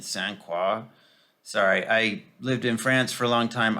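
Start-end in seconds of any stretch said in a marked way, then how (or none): 0.0-0.8 Saint Croix said the French way, sahn KRWAH; French pronunciation. Real, not acted. Vlogger explaining why she says Saint Croix in French accent. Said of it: "I say French words with a French accent".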